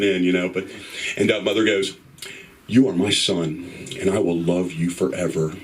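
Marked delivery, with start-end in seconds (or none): 2.7-5.5 low and unhurried, heartfelt; tender reassurance. Retold or imitated. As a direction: voice a mother solemnly reassuring her son. Mother reassures son after coming out.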